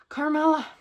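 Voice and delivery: calling out. Real, not acted. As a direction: call her across the room. Calling the rabbit.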